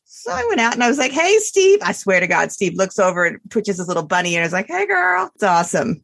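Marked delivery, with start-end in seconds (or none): 1.1-1.8 high, sing-song pet greeting; cooing at a bunny. Retold or imitated. Calling to her bunny. 4.7-5.3 high, cute bunny voice; bunny's voice. Pretend. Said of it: "So that's how my buddy talks".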